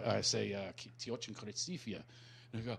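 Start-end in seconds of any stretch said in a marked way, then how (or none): 0.8-2.0 very quiet halting attempt at Russian; softly mumbled Russian phrase. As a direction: fumble a learned Russian line under the breath. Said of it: "I meet a Russian, I go".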